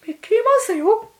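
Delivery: very high, loud cartoon squeak; Mickey Mouse. Shown as a demonstration. Podcaster attempting a mouse impression on air, squeaky falsetto. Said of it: "ミッキーのモノマネします".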